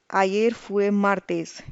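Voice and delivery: ayer fue martes slowly, each word separate; slow clear model answer. Shown as a demonstration. Teacher repeating the model answer, slow and clear. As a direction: spell it out slowly for learners. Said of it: "What day was yesterday?".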